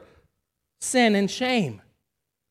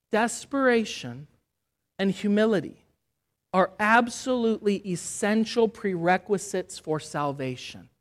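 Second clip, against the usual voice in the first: usual speaking voice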